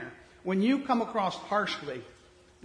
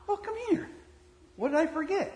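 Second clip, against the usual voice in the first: coy sweet wife; 9 semitones higher than usual. Acted.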